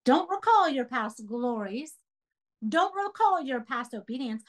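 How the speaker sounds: ordinary talk, nothing marked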